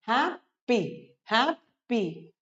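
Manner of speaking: "हैप्पी" broken into hap, pause, py; split syllables. Shown as a demonstration. Teacher drilling two-syllable words, "हैप्पी" split as hap - py. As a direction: say each "हैप्पी" in two separate beats. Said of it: "दो syllable से बने हैं".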